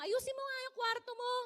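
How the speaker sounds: stressed-out nag about a messy room; stressed snapping. Retold or imitated. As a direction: snap at a sibling over a messy room. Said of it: "kapag ka nagsalita ka ng".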